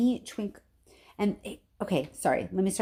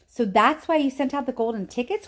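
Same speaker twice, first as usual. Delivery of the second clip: eager, higher realisation; light up as it clicks; excited cry; 6 semitones higher, 3 dB louder than usual. A narrator's reading. Charlie realising why the tickets went out, excited. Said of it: "cried Charlie".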